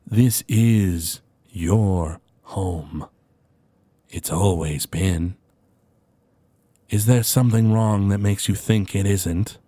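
slow, formal servant voice; a courteous butler speaking with measured calm; attendant NPC. Acted. Attendant calmly reassuring his confused master.

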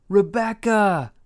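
high, loud, drawn-out scream of her name. A narrator's reading. Levi desperate for Rebecca inside, screamed name. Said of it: "Levi screamed".

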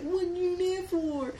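high, wailing mock complaint; mock dismay. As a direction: put on a high, plaintive group protest. Acted reaction to broken mirror.

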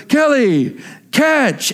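imitating a dad calling across a yard. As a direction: call out a quick heads-up. Dad calls out to mom.

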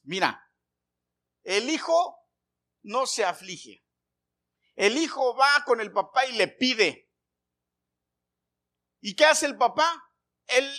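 loud, high shouted sermon; fiery preacher voice, slowing on key words; preaching at full force. Acted. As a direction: shout the promise over the congregation. Preacher quotes Paul, then exhorts.

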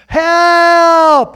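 frantic high shout for help; desperate cry from inside the fish; crying out to God. Acted. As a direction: yell it like a drowning man begging God. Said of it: "he starts crying out to God".

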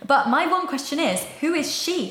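high-pitched, playfully insistent question; playful demanding. Pretend. Host puts a question to absent singer [name], playfully demanding. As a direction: press for an answer in mock exasperation.

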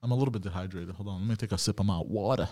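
ordinary talk

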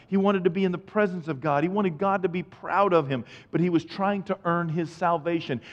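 ordinary delivery

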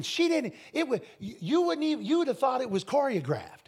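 normal talking voice

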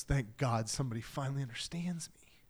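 breathy whisper of relief; relieved whisper. Retold or imitated. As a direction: whisper it like a weight just lifted. Nathanael relieved at being known.

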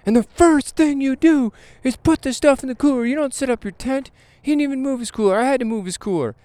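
high, put-on griping voice; imitating the host. Vlogger voicing the host complaining about a careless camper, high and griping. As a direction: grumble like the fed-up ranger. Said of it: "He said".

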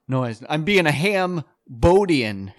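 usual speaking voice

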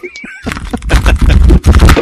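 high, loud laughing shriek. Real, not acted. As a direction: burst into a screaming laugh.

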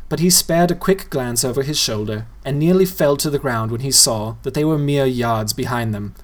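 ordinary delivery, nothing marked

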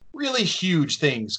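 "huge" leaned on and stretched; stress on huge. Real, not acted. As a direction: put extra weight on the word "huge". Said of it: "Emphasis on the huge".

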